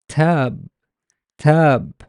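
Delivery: tab with the middle vowel held long; teacher modelling tab, vowel drawn out. Shown as a demonstration. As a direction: stretch out the a in tab. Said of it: "Why the long vowel?".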